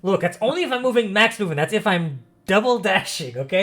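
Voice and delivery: high, loud self-defence; emphatic protest. Real, not acted. Game master defending double dashing to a player, loud insistent protest.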